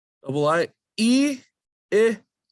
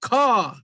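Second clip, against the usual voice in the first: crow caw; 4 semitones higher than usual. Shown as a demonstration. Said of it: "how does a crow sound?".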